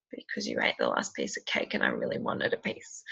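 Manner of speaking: put-on hushed, low voice of a child owning up; imitated quiet confession. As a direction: voice the son softly, almost whispering. Retelling son's reluctant sad confession.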